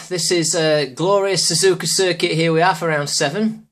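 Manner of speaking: usual speaking voice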